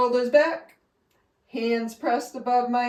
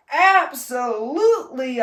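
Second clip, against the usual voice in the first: declaring; 4 semitones higher, 8 dB louder than usual. A narrator's reading. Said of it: "I declared".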